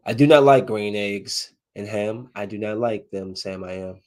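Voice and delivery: Dr. Seuss recital. Acted. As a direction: recite it like a children's book. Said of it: "That's how I felt".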